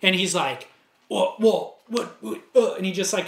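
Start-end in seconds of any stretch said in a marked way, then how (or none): 1.1-2.7 flustered stammer, higher; stuttering. Retold or imitated. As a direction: trip over the words, caught off guard. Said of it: "kinda stuttered".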